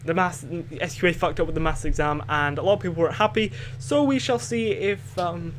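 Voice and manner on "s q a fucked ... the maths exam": ordinary talk, nothing marked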